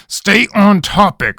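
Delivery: an angry growl to refocus. Acted. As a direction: bark the order. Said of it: "Rabineau growled".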